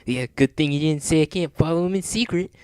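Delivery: smug cartoon hero; mocking. A narrator's reading. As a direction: gloat as if you've outsmarted him. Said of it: "mocked Sonic".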